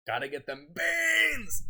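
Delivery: high, stretched-out comic yell; loud meme shout. Pretend. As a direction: holler it slowly, playing up the absurd meme. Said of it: "I'm not used to talking loud".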